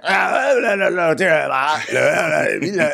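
high-pitched slurred nonsense babble; Sims gibberish. Retold or imitated. Imitating Kyle talking like a Sim, high gibberish. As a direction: babble English-sounding noise with no distinct words. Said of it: "talking like someone from The Sims".

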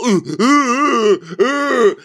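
high, loud drawn-out "ooh"; mock whooping. Cast member mocking the testosterone arriving, high mock whooping. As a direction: hoot it high and long, imitating rowdy guys. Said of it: "they're gonna be all".

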